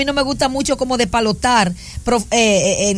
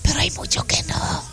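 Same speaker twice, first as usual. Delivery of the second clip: hushed, breathy whisper mid-sermon; whispered aside; 7 dB quieter than usual. Real, not acted. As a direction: drop to a whisper for this line.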